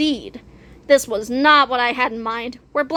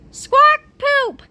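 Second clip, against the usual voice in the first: high, loud parrot squawk; 13 semitones higher, 6 dB louder than usual. Acted. Robot parrot squawks on cue.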